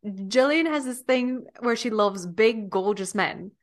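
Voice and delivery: slow attempt at an Australian accent; Nicole Kidman. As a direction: imitate an Australian actress, drawing it out. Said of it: "You do her accent really well".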